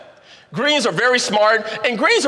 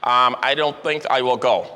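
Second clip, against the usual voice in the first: lower, measured doubtful reply; a hint of a dry, deliberate skeptic; cautious analyst; 7 semitones lower than usual. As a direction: hold back and weigh it coolly. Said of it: "the greens are saying".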